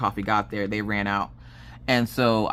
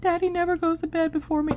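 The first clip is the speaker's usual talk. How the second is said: put-on worried little girl. Daughter asking mom about dad, high worried child voice. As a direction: ask like a worried small child.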